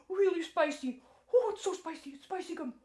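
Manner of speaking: high, gasping mock spicy panic; mouth on fire. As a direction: pretend the gum burns your tongue. Pretends the gum burns.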